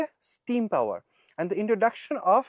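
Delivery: slow, careful reading of a passage; teacher reading an exam passage slowly; reading aloud. Shown as a demonstration. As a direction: read the text out word by word for students. Rereads passage phrase.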